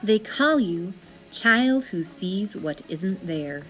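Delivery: usual speaking voice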